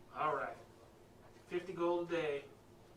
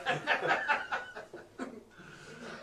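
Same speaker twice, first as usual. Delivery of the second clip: shrill delighted squeal of laughter; high squealing laugh; 4 dB louder than usual. Real, not acted. Still laughing while petting.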